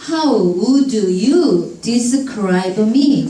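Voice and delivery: "How would you describe me" very slowly sounded out; slow, unlinked "would you describe me"; word-by-word reading. Shown as a demonstration. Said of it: "파닉스로 또박또박 읽다가".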